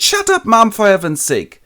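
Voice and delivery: high, loud hiss of embarrassment; exasperated daughter. Retold or imitated. Daughter scolding her mother, high and loud. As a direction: shout to stop your mother.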